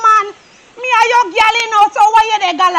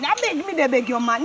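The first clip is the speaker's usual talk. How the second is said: much softer begging; hushed pleading; 7 semitones lower, 10 dB quieter than usual. Acted. Woman asking her man to tone down, softly pleading. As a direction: beg low to tone it down. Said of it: "This tone down".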